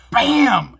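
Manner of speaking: mock crash noise, shouted; loud bam. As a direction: bark out the bang of metal hitting the road. Car part falls off.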